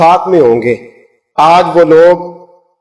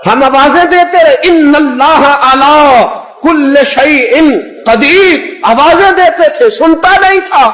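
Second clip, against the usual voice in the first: calling out; 9 semitones higher than usual. Retold or imitated. हाजी पुकारने की नकल.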